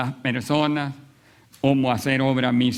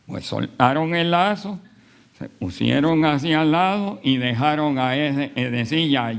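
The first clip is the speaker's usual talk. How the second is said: ordinary talk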